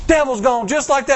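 ordinary delivery

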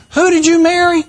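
high-pitched acted inquiry; mock curious question. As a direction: play the nosy classmate, pitched high. Acted classmate's question in commercial.

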